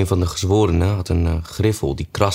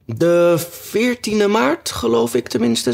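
much higher, very slow, drawn-out answer; high Hatter voice; slower than usual. A narrator's reading. The Hatter answers the king, high and very slow. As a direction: stretch it out, high and unsure.

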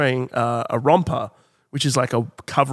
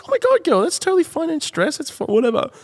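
put-on much higher breezy girl voice, settling down at the end; gushing girly reassurance; 13 semitones higher than usual. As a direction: brush it off like a bubbly young woman. Stranger voiced reassuring Mia.

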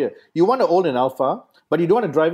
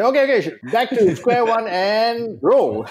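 director voice; 7 semitones higher, 3 dB louder, slower than usual. Retold or imitated.

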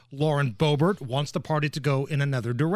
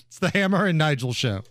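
usual speaking voice

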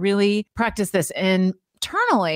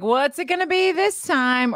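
higher-pitched sarcastic question; 7 semitones higher than usual. Shown as a demonstration. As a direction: ask it with mocking expectation. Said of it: "Like, sarcastic".

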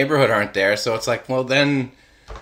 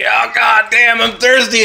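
thirsty plant; 10 semitones higher, 4 dB louder than usual. Pretend.

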